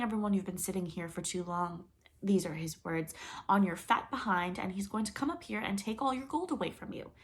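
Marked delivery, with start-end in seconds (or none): none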